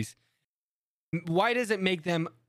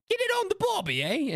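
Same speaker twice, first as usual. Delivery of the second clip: very high, cartoonish British voice; exaggerated mock-British, pitched sky-high; botched British accent. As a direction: squeal out a terrible British impression. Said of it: "he's over here just like".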